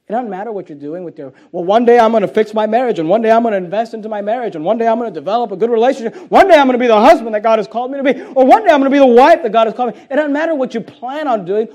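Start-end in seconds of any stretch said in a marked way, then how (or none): 1.5-10.0 fast, loud, building string of promises; rattled-off excuses. Acted. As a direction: reel off each promise faster and louder. Voices people listing postponed promises.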